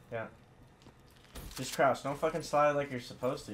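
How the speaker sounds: normal talking voice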